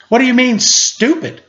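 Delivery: high, indignant stupid?; offended question. A narrator's reading. Linus offended by Charlie Brown's remark, high indignant question. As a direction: push back on the word "stupid" with a high rise. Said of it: "Linus furrowed his brows".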